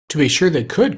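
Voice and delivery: eager, lifted cry; excited cry. A narrator's reading. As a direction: call it out with sudden hope. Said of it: "cried the Scarecrow".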